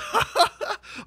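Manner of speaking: very high staccato laugh; mock Alexa laugh. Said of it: "And she's just".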